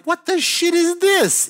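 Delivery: high, drawn-out disbelief; outraged bafflement. Retold or imitated. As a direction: squeal of retold bewilderment, slow and very high. Baffled as the part flies off.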